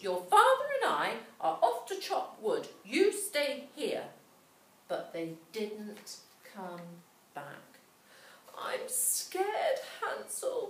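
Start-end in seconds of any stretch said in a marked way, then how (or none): 0.0-4.1 high, loud, brisk stepmother's announcement. A narrator's reading. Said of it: "their stepmother announced". 8.6-10.7 high, whispered frightened cry; a little girl gasping out her fear; scared cry. A narrator's reading. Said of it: "cried Gretel".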